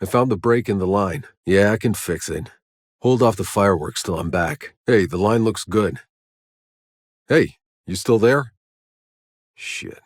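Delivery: ordinary talk, nothing marked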